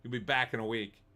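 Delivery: ordinary delivery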